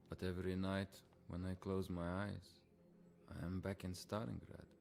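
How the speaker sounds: low, subdued and weary; haunted confession. Acted. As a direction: confide a recurring nightmare quietly. Haunted soldier confides his nightmares.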